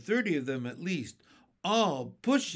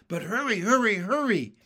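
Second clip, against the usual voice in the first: high, loud hurrying; urgent; 6 semitones higher, 7 dB louder than usual. A narrator's reading. Centipede rushing James as sharks attack, high loud urging. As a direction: hustle them along, louder on each repeat.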